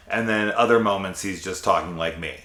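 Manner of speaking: ordinary talk, nothing marked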